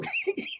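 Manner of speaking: high-pitched burst of laughter; laughing. Real, not acted. Commentator cracks up over the double punch, high laughing. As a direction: crack up at your own joke.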